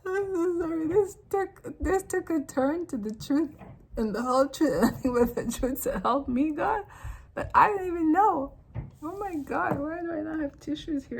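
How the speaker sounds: high-pitched words through real laughter; helpless amusement. Real, not acted. Sorry for the turn to truth, helplessly laughing. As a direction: apologize while cracking up. Laughing fit, apologizes, wants tissues.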